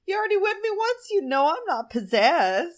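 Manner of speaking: high-pitched, exaggerated protest; mock indignation. Podcaster voicing a whipped character insisting he isn't possessed, mock indignant. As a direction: protest it with a hammed-up whine of complaint.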